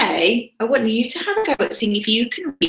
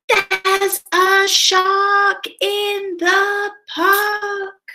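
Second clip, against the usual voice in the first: high, long-drawn storybook yell; yelling with all his might; 11 semitones higher, much slower than usual. Acted. Timothy warns everyone about the shark, yelling with all his might. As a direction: stretch each word into a high cry. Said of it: "yells with all his might".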